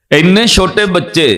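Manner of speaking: loud, high exclamation of amazement; astonished. Retold or imitated. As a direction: imitate a stunned onlooker, voice jumping up. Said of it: "ਉਹ ਹੈਰਾਨ ਹੋ ਕੇ ਕਹਿੰਦਾ".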